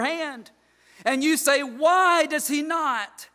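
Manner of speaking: loud, rising pulpit delivery; preach. Acted. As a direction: thunder the verse at the congregation. Preaching scripture at full force.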